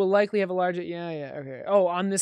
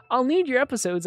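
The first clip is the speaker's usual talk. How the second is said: high, loud mock eagerness; gushing all-caps writer; 6 semitones higher, 6 dB louder than usual. Reading an excited writer's note aloud, hammed-up and gushing. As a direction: push the over-keen announcer act. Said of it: "I read it like that".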